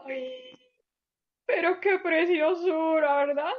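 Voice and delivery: high, gushing "ay" of delight. Real, not acted. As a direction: melt over how sweet it was. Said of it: "gushing over the story".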